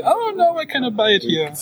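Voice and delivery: high-pitched imitation of upset customers; mock screaming complaint. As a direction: wail it in mock dismay, pitch shooting up on "Oh no". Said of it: "people are screaming and say".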